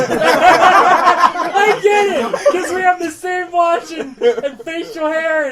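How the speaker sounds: laughing through the words. Real, not acted. Laughing realisation.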